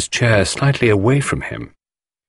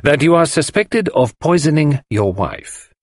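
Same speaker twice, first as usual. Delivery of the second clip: higher-pitched, weighted accusation; deliberate; 7 semitones higher than usual. A narrator's reading. Poirot tells husband he is suspected, deliberately. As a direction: spell it out word by word. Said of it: "said Poirot deliberately".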